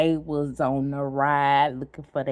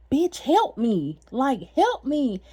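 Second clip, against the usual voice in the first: slow, higher pleading cry for help; desperate plea for help; 8 semitones higher, 4 dB louder, slower than usual. Retold or imitated. To girlfriend while being punched, pleading for help. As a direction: drag out help me, begging. Begging mid-fight.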